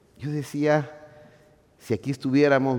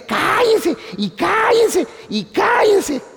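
much higher, shouted "Cállense" over and over; mock teacher yelling; 8 dB louder, much slower than usual. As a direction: bark it like a fed-up teacher at noisy kids. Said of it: "las maestras solo dicen".

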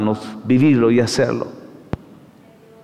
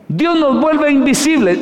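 much higher, ringing proclamation; preaching shout; 12 semitones higher than usual. Real, not acted. Preacher repeats the point to the congregation in a ringing shout. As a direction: cry it out to the congregation.

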